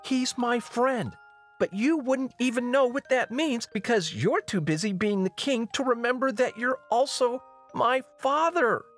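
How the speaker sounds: high, strained, indignant tirade; impassioned outburst. Acted. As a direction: pour out your grievance at your father. Princess berating the king.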